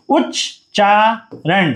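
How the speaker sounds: उच्चारण drawn out slowly while writing; slow dictation. Shown as a demonstration. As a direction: stretch उच्चारण out for the class. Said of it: "ये आपका हिंदी है".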